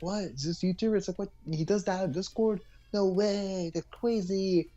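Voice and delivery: lightly hammed-up gossiping viewer; mock shocked fan. Parodying drama viewers for his co-host, mock shock. Mocking fans' shocked reactions.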